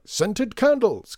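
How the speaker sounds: high, loud, stretched-out exclamation; delighted cry. A narrator's reading. Shopkeeper seizing on Lydia's request, crying out grandly. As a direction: sing out the words like a showman. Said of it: "cried Mister M".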